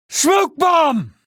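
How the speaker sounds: showy ninja cry; dramatic shout. Acted. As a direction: announce your entrance. Ninja entrance, calling out.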